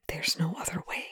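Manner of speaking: soft breathy whisper. A narrator's reading. Rush telling Perry it must be done, whispered. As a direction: whisper it, resigned. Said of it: "Rush whispers".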